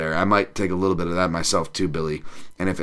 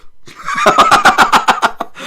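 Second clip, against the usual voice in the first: high squealing laugh; 7 dB louder than usual. Real, not acted. Laughing at own joke.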